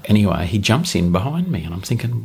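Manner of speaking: ordinary talk, nothing marked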